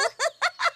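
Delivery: high amused giggle after a prank. Acted. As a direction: laugh it off, a bit guilty. Sandy laughs after the prank.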